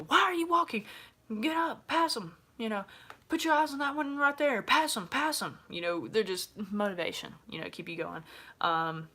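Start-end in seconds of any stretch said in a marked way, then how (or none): 0.0-5.6 high, loud drill-instructor yelling; recruiter yelling. Retold or imitated. Recruiters yelling at runners to keep going, loud and high. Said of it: "they're gonna yell at you".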